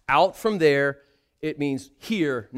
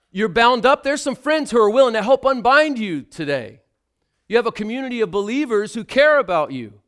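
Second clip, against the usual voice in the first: loud, high preaching build; rally the congregation with fire; full-force sermon voice; fervent preaching; 8 semitones higher, 7 dB louder than usual. Acted.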